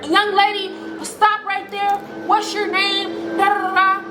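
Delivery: high, sustained mom voice; mock motherly command, held high; scolding mother. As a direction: call her to a halt like a stern mom. Mama orders her to stop.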